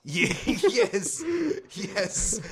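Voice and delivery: laughing yes, high and loud. Real, not acted. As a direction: laugh through the yeses. Laughing while agreeing.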